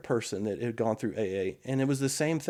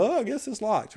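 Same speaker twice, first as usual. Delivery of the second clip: high, offhand give-up voice; mock shrug; 9 semitones higher than usual. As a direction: toss it off like it doesn't matter. Mock churchgoer giving up at locked door.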